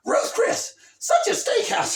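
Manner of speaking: high, loud mock scold; hammed-up shouting mother; scolding mom. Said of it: "she's like".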